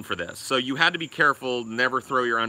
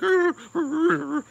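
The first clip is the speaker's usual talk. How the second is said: long, high drawn-out whimper; imitated dog whine; 3 dB louder than usual. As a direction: stretch it into a dog's whimper. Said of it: "He's like".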